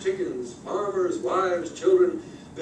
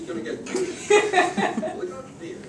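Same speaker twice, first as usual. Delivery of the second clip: laughing; 11 semitones higher, much slower than usual. Real, not acted. Laughing aside mid-story.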